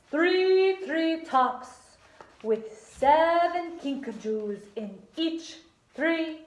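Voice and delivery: theatrical, badly faked Italian encouragement; bad Italian accent. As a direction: stretch the words in a hammed-up Italian accent. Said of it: "I gotta work on my Italian accent".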